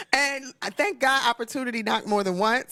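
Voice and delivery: normal talking voice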